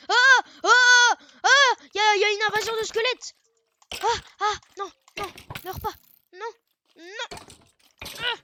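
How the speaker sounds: frantic shouting; panicked yelling. Real, not acted. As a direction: yell in real panic as monsters attack. Skeleton invasion panic.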